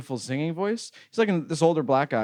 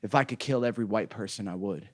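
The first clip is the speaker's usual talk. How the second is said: quiet, lowered aside from an unhinged man; imitating darkened mood; 5 semitones lower, 6 dB quieter than usual. Train man's disposition changes, imitated quiet menace. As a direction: drop low and soft, deadpan menace. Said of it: "his disposition changes".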